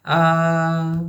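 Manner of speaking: a groaning ah of dismay; phone in the toilet, long dismayed ah. Pretend. Mock dismay at a lost phone, an ah. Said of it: "when you realize your phone fell into the toilet".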